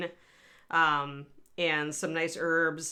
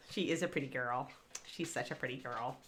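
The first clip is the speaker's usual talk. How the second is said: soft, lower doting murmur; fond praise; 6 semitones lower, 10 dB quieter than usual. Real, not acted. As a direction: dote on the dog quietly. Praising dog after chat comment.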